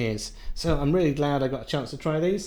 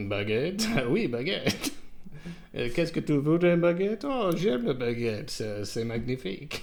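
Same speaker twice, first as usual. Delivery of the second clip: mock French accent; 2 semitones higher than usual. Playful mock French bit.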